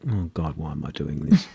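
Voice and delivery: low, quiet mock dismay; imitating a weary self-questioning; weary regret. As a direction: mutter it like someone dreading the day. Imagining leaders waking up full of regret.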